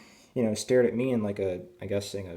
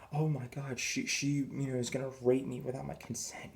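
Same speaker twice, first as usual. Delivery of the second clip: lightly hammed-up alarm, pitched high; mock horror; 5 semitones higher, 4 dB quieter than usual. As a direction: play at being scandalized, higher voice. Second mock accusation he wouldn't make.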